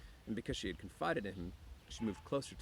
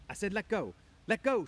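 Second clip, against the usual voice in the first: high, loud demand, building louder; angry demand; 12 semitones higher, 9 dB louder than usual. A narrator's reading. Woman to Lenny gripping her hair, shouting angrily. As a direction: cry out to be released.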